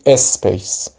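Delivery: "اسپیس" as es-peys, an e put before the s; wrong leading vowel. Shown as a demonstration. Teacher shows second wrong reading, vowel before the s. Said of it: "درست نیست بگیم".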